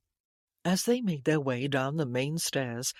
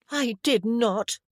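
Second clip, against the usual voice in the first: high, loud, slow protest; indignant denial; 6 semitones higher, 5 dB louder, slower than usual. A narrator's reading. Sister denies telling the lie, indignant. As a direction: deny it with drawn-out emphasis. Childish quarrel between brother and sister.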